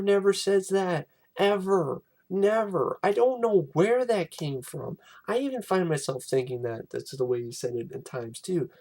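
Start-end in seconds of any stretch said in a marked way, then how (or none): none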